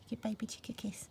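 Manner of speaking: soft unvoiced whisper. Shown as a demonstration. Teacher whispering beside a sleeping toy chick. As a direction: breathe it out gently.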